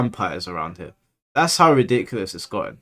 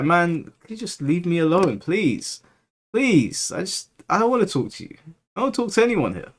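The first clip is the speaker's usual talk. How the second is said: whiny plea; 6 semitones higher than usual. Retold or imitated. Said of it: "I was just like".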